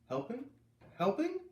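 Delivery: very high, rising helping; can't believe the idea; aghast disbelief. Acted. Kids aghast at chore.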